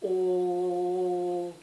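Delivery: "O" held very long on a steady pitch; lengthened vowel. Shown as a demonstration. Teacher showing that vowels can be lengthened, long steady "O". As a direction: sustain "O" evenly for seconds. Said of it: "les prononcer en les allongeant".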